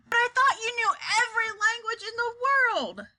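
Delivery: high, indignant outcry; incredulous protest. A narrator's reading. President challenging his chief interpreter, high and incredulous.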